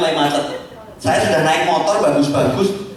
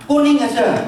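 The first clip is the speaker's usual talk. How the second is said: high, playful wife voice; a wife who has already decided, pitched high; mock wife insisting; 8 semitones higher, slower than usual. Wife overrules husband on dress colour, high and insistent.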